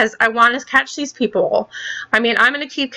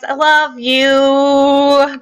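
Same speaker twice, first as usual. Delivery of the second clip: very slow, stretched sing-song farewell; drawn-out sign-off; 2 semitones higher, much slower than usual. Real, not acted. Girl signing off to her viewers, slow and stretched. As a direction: draw every word out lovingly.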